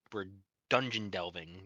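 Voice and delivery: usual speaking voice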